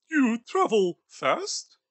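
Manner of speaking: put-on a nervous old skinflint questioning a ghost; Scrooge voice.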